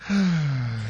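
long disappointed sigh; deflated sigh. Acted. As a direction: let out a heavy breath of letdown. Disappointed after losing the scholarship.

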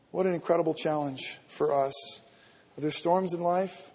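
normal talking voice

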